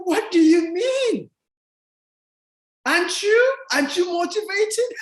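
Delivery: high, exclaiming disbelief; incredulous retort. Retold or imitated. Answering people who ask why he's motivated, incredulous.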